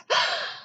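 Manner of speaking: sharp intake of breath; surprised gasp. A narrator's reading. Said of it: "Lucy gasped".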